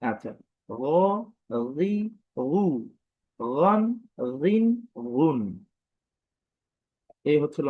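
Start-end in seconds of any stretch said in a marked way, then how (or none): 1.8-5.6 রি, রু said slow and separate; teacher spells out each vowel form; slow vowel drill. Shown as a demonstration. Teacher drilling students on vowel forms, slow. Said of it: "মোটেও টান দিব না".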